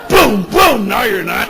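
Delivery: loud punchy shout. Real, not acted. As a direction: belt it out. Punchline outburst.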